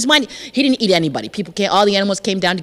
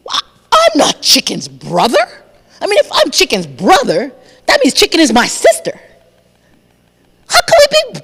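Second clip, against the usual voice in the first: rising to a much higher squeal of disbelief; flummoxed crocodile; 4 semitones higher than usual. Acted. Crocodile puzzles over being Chicken's brother, flustered. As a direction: argue with yourself, baffled. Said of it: "Crocodile was flummoxed".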